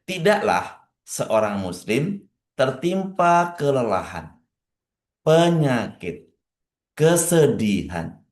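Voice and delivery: pace each word so listeners can write it down; slow dictation. Shown as a demonstration. Preacher rereads the hadith for the congregation to copy, slow and deliberate. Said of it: "Kalau mau tulis boleh".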